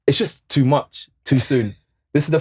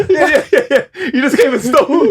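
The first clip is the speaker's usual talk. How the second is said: high, laughing protest; laughing while accusing; 10 semitones higher, 5 dB louder than usual. Real, not acted. Laughing at friend stealing point.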